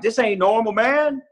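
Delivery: recalled protest to himself, pitched well up; retold alarm. Man telling himself his crying has gone too far, retold alarm.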